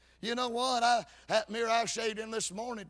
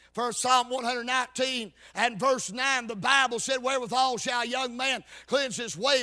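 loud, raised scripture recitation in sustained preacher's drive; full-force preaching; 4 semitones higher, 6 dB louder than usual. Real, not acted. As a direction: hammer out the verses at full volume.

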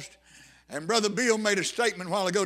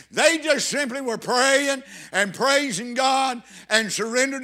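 full-force preaching; 3 semitones higher, 4 dB louder than usual. Acted. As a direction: preach it hard, driving each phrase home.

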